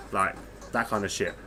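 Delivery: ordinary delivery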